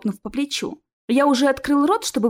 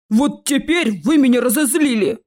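loud, menacing troll; angry rumble; 3 semitones higher, 5 dB louder than usual. Acted. Troll behind the fleeing students, rumbling angrily. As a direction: boom it out in wicked anger. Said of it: "пророкотал позади нас злобный голос".

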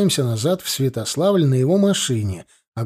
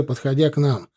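plain reading voice

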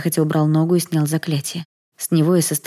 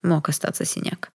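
soft, hushed observation; quiet remark from the stranger; 5 dB quieter than usual. A narrator's reading. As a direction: say it softly, under the voice. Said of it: "тихо сказал незнакомец".

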